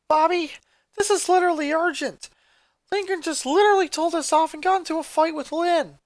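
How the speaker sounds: put-on a sister texting her boyfriend about her missing brother; upset teen girl. A narrator's reading. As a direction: plead through the message. Text message read in voice.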